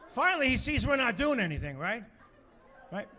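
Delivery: ordinary delivery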